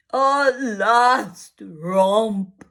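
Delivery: very slow mournful groan; father groaning over lost children. Acted. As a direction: mourn the last romp. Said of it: "Mister Darling groaned".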